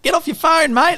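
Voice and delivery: Bluey telling her dad off for his phone; bossy child. Retold or imitated. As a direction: boss dad around like a cheeky kid. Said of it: "she's like".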